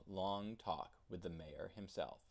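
ordinary delivery

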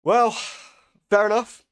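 patient giving in to the goth therapist; resigned patient. Retold or imitated. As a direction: chirp it like happily giving in. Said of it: "And you're like".

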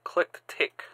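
normal talking voice

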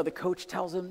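ordinary talk

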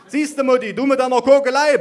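high, loud scolding yell; yelling mother. A narrator's reading. Mother yells at grandma over her candles. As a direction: bellow it at grandma. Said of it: "brüllte meine Mutter".

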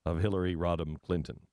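low-pitched, lightly put-on announcer read; mock show promoter. Announcer selling a live performance, mildly mock promo. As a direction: plug it like a theatre advert, dropping low.

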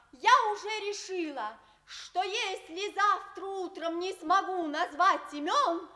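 ordinary delivery

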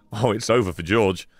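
ordinary talk

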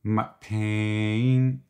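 "pain" drawn out longer, "my" lighter; stress on pain. Shown as a demonstration. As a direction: lean into a long "pain". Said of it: "Less emphasis on my and more of a longer pain".

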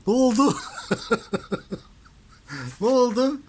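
high, loud coo at the cat; pet talk. Real, not acted. Owner asks her startled cat what happened, high and loud.

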